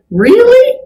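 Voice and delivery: high sharp disbelieving Really; incredulous Really. Retold or imitated. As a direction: throw it back at them, stunned. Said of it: "And I just said".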